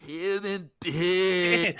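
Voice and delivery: slow, drawn-out, deadpan; casual Jason voice as God. Retold or imitated. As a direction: say it lazily like an ordinary guy. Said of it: "it says, like".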